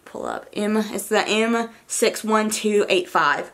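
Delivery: ordinary talk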